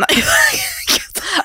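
high burst of real laughter; bright peal of laughter. Real, not acted. Laughing at friend's teasing.

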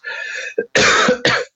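a real dry cough; clearing the throat with a cough. Real, not acted. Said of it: "Excuse me, I'm gonna cough".

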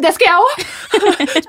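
high, slow, laughing panic; panicked agreement. Retold or imitated. To mom on the rolling bus, laughing high panic. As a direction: cry it out half laughing in fear.

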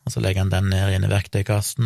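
ordinary talk

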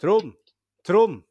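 mock holler, high and very loud; calling the dog. Man on doorstep at midnight, calling the dog loudly. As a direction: shout for a dog out into the night. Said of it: "han sto på trammen da".

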